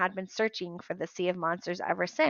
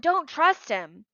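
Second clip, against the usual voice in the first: urgent warning; 8 semitones higher, 6 dB louder, slower than usual. Acted. Grover continues warning.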